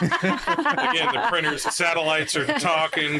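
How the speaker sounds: cracking up mid-joke; real laughter, slow and loud; laughing. Real, not acted. Joking while laughing hard.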